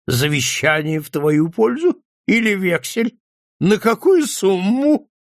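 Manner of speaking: high-pitched, hilarious mock questioning; drunken mirth, pitch climbing; mildly choked amusement. A narrator's reading. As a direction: tease him as if stifling laughter. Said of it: "давясь от смеха, выговорил он".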